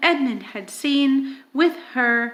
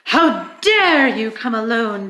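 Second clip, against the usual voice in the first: louder, cold queenly fury; terrible voice; 6 dB louder than usual. Acted. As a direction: hit him with it like a threat. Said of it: "said the witch in a terrible voice".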